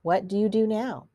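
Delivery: ordinary delivery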